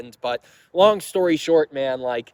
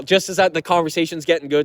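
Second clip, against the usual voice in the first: ordinary talk, nothing marked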